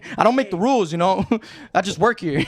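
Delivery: high placating clerk voice breaking into laughs; mock polite clerk, laughing. To angry residents, high mock-polite voice with laughter. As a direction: play the helpless employee, cracking up. Mocks customer-service placating.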